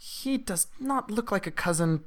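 high-pitched, halting kobold voice; baffled kobold. Acted.

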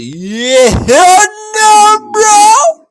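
high, loud shouted refusal; screeching hell no at the top of the lungs; mock shout. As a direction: yell it in a high put-on voice. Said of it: "She's like".

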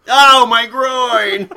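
loud, slow pained cry; just hit in the groin. Retold or imitated. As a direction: howl as if just hit below the belt.

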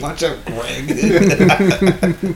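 very slow, drawn out through laughter; laughing mock warning. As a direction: stretch it out while cracking up.

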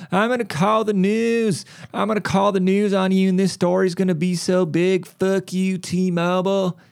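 hammed-up irate customer threatening to call the news; mock angry customer. Angry customer voiced in retail story.

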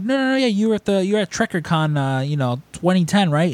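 high, rushed imitation of an eager fan; insistent correction. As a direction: brush off the denial and press on. Strangers overrule Frank's denial.

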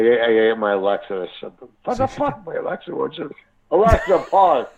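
ordinary talk, nothing marked

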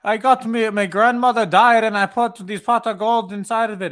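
high, loud, hammed-up fake Irish accent; mock the actors' fake Irish accent; over-the-top put-on Irish accent; bad fake Irish accent. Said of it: "What the fuck is this accent".

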